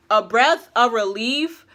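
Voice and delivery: ordinary talk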